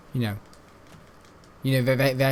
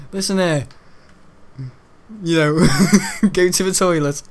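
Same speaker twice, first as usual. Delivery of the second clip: mock dismissal; 5 semitones higher, 6 dB louder than usual. Mock-shooing the chatty lad.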